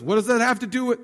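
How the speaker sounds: a teasing, curt put-down; dismissive tone. Retold or imitated. Preacher voicing Jesus brushing off his mother, mock-snippy. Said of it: "he didn't say it with that tone".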